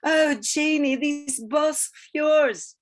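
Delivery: high, loud, drawn-out gushing; gushing flirtatious Peggy. A narrator's reading. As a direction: swoon over him in a high, slow voice. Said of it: "came back to her with fluttering lashes".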